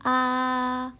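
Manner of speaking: high level "a"; "a" sung-flat at a high, steady pitch; first tone. Shown as a demonstration. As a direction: hold "a" high and level, no movement. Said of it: "Nada satu ... datar".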